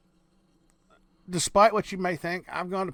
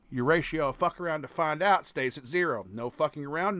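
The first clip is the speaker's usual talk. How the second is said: normal talking voice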